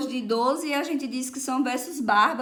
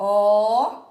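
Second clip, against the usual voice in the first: syllable by syllable; 2 semitones lower, 5 dB louder than usual. Shown as a demonstration. Teacher counting a verse's syllables for pupils, syllable by syllable. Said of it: "Como é que eu vou contar aqui".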